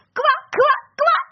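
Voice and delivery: high laughing ribbits; laughing croak. A narrator's reading. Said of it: "засмеялась лягушка".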